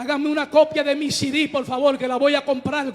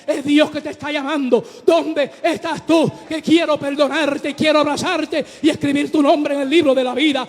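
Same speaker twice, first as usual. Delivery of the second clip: fiery preaching; 6 dB louder, faster than usual. Acted. Pastor to congregation about forgiveness, fiery loud preaching. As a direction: preach it out full force to the church. God calling the listener.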